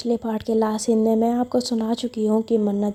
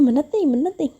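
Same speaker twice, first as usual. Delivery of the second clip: mimicking her protest in a higher, teasing voice; mocking imitation; 5 semitones higher, faster than usual. Mannat mocks Piya's protest back at her, a higher teasing voice. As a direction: throw her own words back at her, pitched up. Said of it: "अब कह रही हो".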